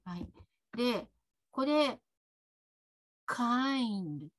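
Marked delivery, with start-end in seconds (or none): 3.3-4.3 "kind" as one drawn-out English syllable; English "kind", final d barely released; English pronunciation. Shown as a demonstration. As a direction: say "kind" with no vowel after the d. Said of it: "と発音します".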